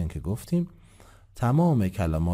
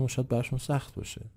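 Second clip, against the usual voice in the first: ordinary talk, nothing marked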